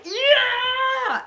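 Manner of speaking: high, loud whoop of joy; cheering yell. Retold or imitated. Fan leaping up in the theater, whooping. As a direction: scream it like you're jogging laps in the theater. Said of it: "just like".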